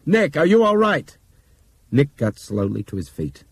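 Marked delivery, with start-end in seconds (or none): 0.1-1.2 loud, high anxious call; worried shout. Acted. Pilot shouting to Nick, worried loud call. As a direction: shout the question over the noise. Pilot calls back to hurt copilot.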